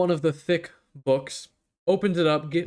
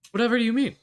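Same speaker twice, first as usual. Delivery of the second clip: mock innocent; 8 semitones higher, much faster than usual. Rick feigning innocence.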